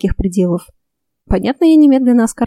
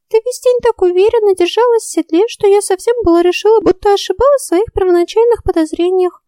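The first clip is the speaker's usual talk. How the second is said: high, chattering little fairy; 12 semitones higher than usual. A narrator's reading. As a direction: voice a tiny teasing fairy. Fairy Ulrika voiced high.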